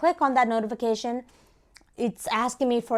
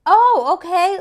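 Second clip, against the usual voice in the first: high, loud imitation of her reaction; lightly voiced caller reacting loudly; retold woman caller; 8 semitones higher, 10 dB louder, slower than usual. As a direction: mimic a caller taken aback, pitched up. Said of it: "she's like".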